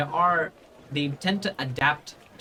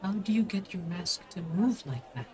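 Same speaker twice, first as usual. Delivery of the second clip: put-on higher, slightly hushed child voice; curious child; 5 semitones higher, 4 dB quieter than usual. Child puzzled by a stranger's moving mask, small curious voice. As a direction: ask in a small wondering voice. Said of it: "he just goes like".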